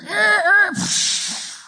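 very high squealing screech then a hissed crash; tire screech and crash. Retold or imitated. The speeding car going off the broken bridge, imitated screech and crash. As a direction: sound out the skid and the splash of the crash.

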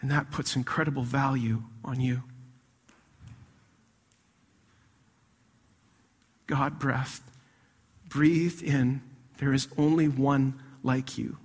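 a hushed, tender sermon about God's love; soft preaching. Real, not acted. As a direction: draw them close and speak gently. Soft intimate preaching passage.

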